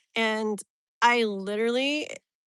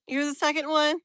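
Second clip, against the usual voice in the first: ordinary talk, nothing marked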